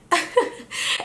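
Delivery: high breathy laugh; airy giggle. Real, not acted. Giving up on mocking her, laughing. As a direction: giggle it out, unvoiced. Laughs after failing to mock.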